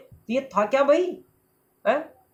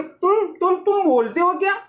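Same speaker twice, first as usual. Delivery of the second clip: put-on higher, amazed girl voice; girl amazed the letter box speaks; astonished girl; 6 semitones higher than usual. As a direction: stammer in disbelief. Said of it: "लड़की कह रही है".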